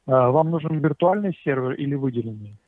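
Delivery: normal talking voice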